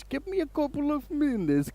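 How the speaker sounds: very high, slow Eastern European tech guy; Eastern European accent. Retold or imitated. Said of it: "he says".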